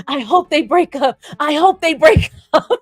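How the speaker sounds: high, wishful chant, ending in a laugh; Dorothy clicking heels. Acted. Haters clicking their heels like Dorothy, wishful chanting. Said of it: "clicking their heels like Dorothy".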